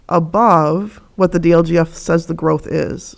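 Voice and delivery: ordinary talk, nothing marked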